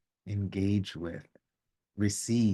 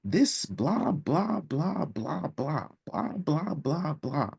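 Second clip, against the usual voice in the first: high, singsong mock of his droning blah blah; mocking husband; 8 semitones higher, slower than usual. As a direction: parody the husband's unkind lecturing. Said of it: "And he was saying".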